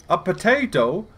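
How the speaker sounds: high-pitched mock British meme voice; British accent. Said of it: "says it like a British accent".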